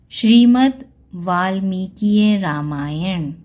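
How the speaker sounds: Shrimad वाल्मीकीय Ramayan read slowly, word by word; slow careful reading. Shown as a demonstration. Teacher reading a Sanskrit title aloud, slow. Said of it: "तीसरा शब्द है".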